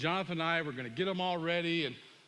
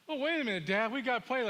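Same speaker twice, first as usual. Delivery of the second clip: higher-pitched objecting son; retold teen protest; 6 semitones higher, faster than usual. Teenage son objects to family day, protesting. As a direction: plead prior plans in a raised pitch.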